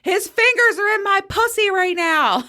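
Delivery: high excited screamed line; screaming. Retold or imitated. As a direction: squeal it into her ear. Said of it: "she screams in my ear".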